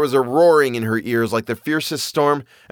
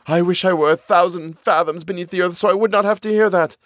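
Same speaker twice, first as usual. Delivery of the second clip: terrified woman; 7 semitones higher, faster than usual. A narrator's reading. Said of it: "the woman was terrified".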